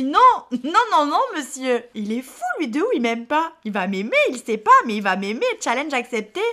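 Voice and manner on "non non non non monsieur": firm loud refusal. Acted. Firm refusal to his offer.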